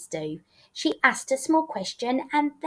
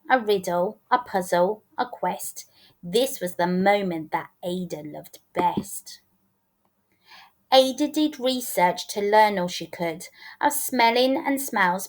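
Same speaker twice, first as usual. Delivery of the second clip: usual speaking voice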